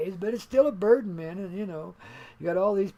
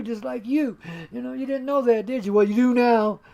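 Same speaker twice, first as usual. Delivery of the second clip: ordinary delivery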